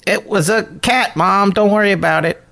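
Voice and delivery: son placating mom. Acted. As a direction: call back innocently to your mother. Son placating his suspicious mom.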